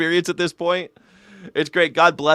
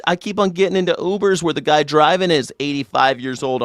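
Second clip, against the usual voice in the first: ordinary talk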